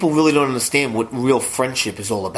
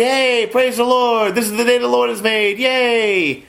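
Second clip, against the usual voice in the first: mock churchy greeting; 6 semitones higher, slower than usual. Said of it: "We get greeted at church".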